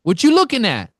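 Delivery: loud, high, hostile street challenge; hammed-up Brooklyn tough guy snapping at a stranger; angry Brooklyn guy. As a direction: bark it like a tough guy picking a fight. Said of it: "He's like".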